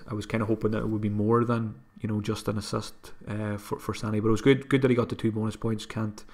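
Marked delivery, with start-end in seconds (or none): none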